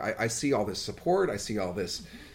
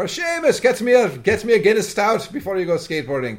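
Irish stepfather; 7 semitones higher, 4 dB louder than usual. Retold or imitated.

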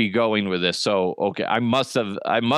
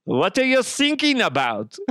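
put-on high, puzzled German accent; German coast guard; 8 semitones higher than usual. German coast guard answering sinking caller, puzzled accented voice. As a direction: ask innocently in a thick German accent. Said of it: "This guy is playing a Coast Guard".

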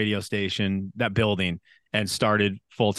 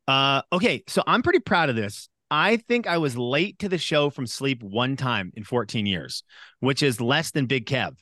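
ordinary delivery

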